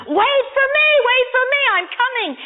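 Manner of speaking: high-pitched, urgent storyteller's yell; acted screaming. Boy running after the moving train, mock screaming. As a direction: cry out after a departing train. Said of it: "screaming at the top of his lungs".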